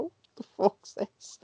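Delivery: soft, slow, baffled hiss; retold whisper. Wrestler baffled by his opponent's plea, whispering. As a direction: whisper it in disbelief into his ear. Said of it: "I just whispered in his ear".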